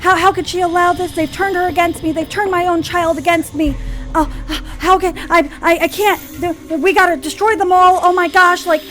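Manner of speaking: high, loud, frantic rant; outraged panic. Acted. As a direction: shriek in disbelief as a betrayed mother. Mother acted raging at betrayal news.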